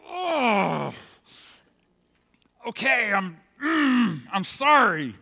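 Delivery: put-on loud, exasperated groan of a kid giving in; grudging apology. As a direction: force the words out, high and huffy. Acting child's grudging forced apology.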